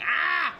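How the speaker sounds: short high, loud yelp; playful scream of dread at the sight of it; mock scream. Mock scream at the glue gun.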